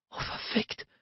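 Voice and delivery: very soft, breath-only moaned curse. A narrator's reading. Narrator voices a woman overwhelmed by her lover, moaning. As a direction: let the curse out on a voiceless moan. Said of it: "stöhnte sie".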